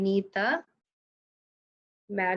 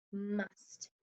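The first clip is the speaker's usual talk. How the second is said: soft blended word; 12 dB quieter than usual. Shown as a demonstration. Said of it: "Spell it".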